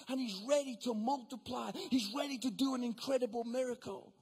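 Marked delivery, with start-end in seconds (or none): none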